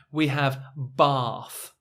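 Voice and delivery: bath with a long ah, slow. Shown as a demonstration. Teacher points left, says long ah bath. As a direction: slowly say bath as bahth. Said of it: "on the left".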